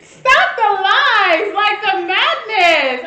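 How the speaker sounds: loud, slow, drawn-out protest; exasperated outcry. Real, not acted. Co-host fed up with the husband's lies, loud drawn-out outcry. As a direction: cry out, stretching each word in exasperation.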